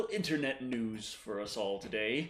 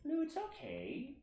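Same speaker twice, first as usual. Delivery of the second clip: coaxing pet voice; 14 dB louder than usual. Real, not acted. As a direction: coax the dog gently. Coaxing the dog.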